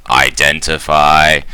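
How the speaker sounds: stretched, mechanical syllables; monotone robot voice. Acted. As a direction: intone it like a scanning machine. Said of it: "monotone robot voice".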